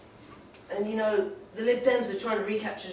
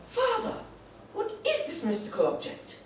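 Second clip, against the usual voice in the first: put-on boy's awed question; 3 dB quieter than usual.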